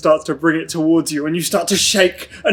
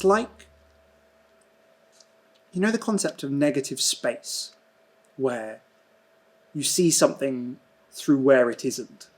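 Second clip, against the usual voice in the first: dreamy scene narration; 9 dB quieter than usual. Acted. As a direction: let the words float like drifting in nothing. GM narrates Mason's drifting dream.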